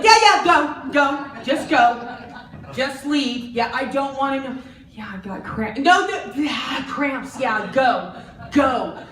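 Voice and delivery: loud, high hurried dismissal; flustered brush-off. Retold or imitated. Boss rushing the woman out at mention of cramps. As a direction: shoo her out fast, flustered. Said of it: "they're like".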